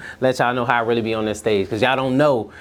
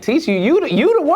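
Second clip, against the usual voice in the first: surprised retold reply; 14 semitones higher, 4 dB louder than usual. Retold reaction, high pitch jump.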